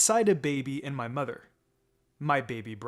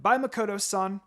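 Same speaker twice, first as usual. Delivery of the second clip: bright, higher call of farewell; cheery goodbye; 6 semitones higher, 5 dB louder than usual. Acted. Child calling goodbye to Makoto-san, cheery and higher. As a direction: wave and call it out.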